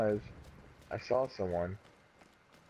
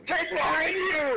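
high slow warning shout; scared horror game streamer; 8 semitones higher, slower than usual. Real, not acted. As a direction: yell the warning in panic. Warning teammate while chased.

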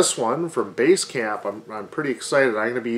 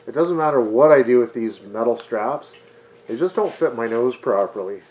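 muffled behind paper mask; speaking through dust mask; through a face mask; 4 dB louder than usual. Real, not acted.

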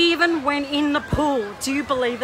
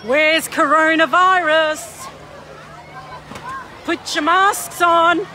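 slow, loud taunt across the pool; mocking call-out; 5 dB louder, slower than usual. Real, not acted. Vlogger taunts maskless swimmers at the pool, loud and slow. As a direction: call it out to the crowd with scorn.

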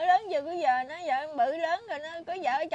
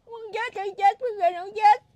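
crying out loud, voice breaking with grief; louder wails through the tears; real sobbing; 3 semitones higher, 11 dB louder than usual. Real, not acted. Grandmother pouring out despair to the interviewer, sobbing loudly. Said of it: "Buồn lắm chú ơi".

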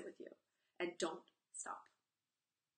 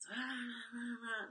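put-on whiny teen groan, higher; reluctant groan; 4 semitones higher, much slower than usual. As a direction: groan like a kid dragged along. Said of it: "that energy".

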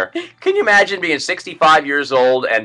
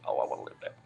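breathy, dreamy wife quote; whispery wife voice; 15 dB quieter, much faster than usual. Retold or imitated. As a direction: sigh out the wish. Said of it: "she's like".

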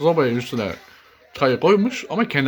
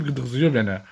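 ordinary delivery

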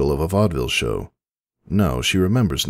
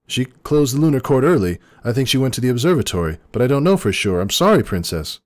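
high, louder, rushed frightened answer; frightened servant; 9 semitones higher, 4 dB louder, faster than usual. A narrator's reading. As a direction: babble it out in fear. Frightened stallion stumbles, shaking hoof.

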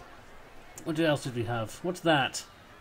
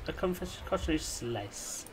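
a put-on posh-ish way of saying it; odd voice; 7 dB quieter than usual. Building a theme park, says the shop name in a silly voice. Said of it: "I don't know why I said it like that".

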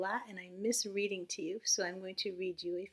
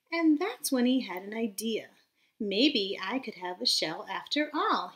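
lively storybook read-aloud voice; reading to children; 5 semitones higher, 7 dB louder, slower than usual. A narrator's reading. Teacher reading a storybook aloud to her class, lively. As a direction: read it bright and animated for little listeners. Reads picture book to children.

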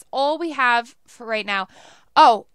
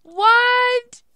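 high, loud shocked what; loud high disbelief; 11 semitones higher, 10 dB louder than usual. Real, not acted. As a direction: yelp it in disbelief. Can't find the house.

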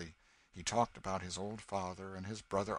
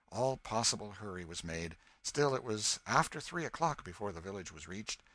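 ordinary delivery, nothing marked

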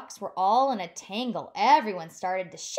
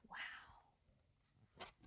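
breathless whispered wow; awed whisper; 24 dB quieter than usual. Acted. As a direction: hush in wonder for the children. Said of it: "whispered wonder to kids".

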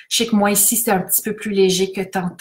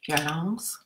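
quieter word; 4 semitones lower, 8 dB quieter than usual. Shown as a demonstration. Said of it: "alternance violence calme".

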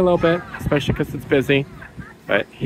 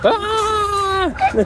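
mock scream. Pretend scary ride.

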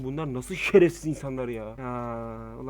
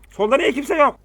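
shouting; 11 semitones higher, 10 dB louder than usual. Real, not acted. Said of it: "plain take just before".

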